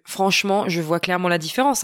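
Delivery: ordinary talk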